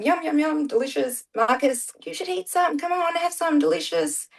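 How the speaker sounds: high, sing-song food enticement; coaxing a child. Retold or imitated. Family coaxing fussy child to eat.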